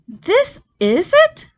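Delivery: high, slow excited cry; cried out. A narrator's reading. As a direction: cry it out in wonder. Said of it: "cried Mister Fox".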